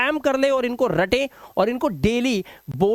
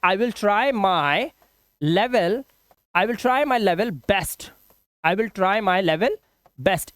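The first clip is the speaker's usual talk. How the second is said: slow chunked dictation; much slower than usual. Shown as a demonstration. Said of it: "या फिर आप ये भी बोल सकते हैं".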